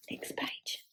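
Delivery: whispered page cue. Real, not acted. Page-turn whisper to child.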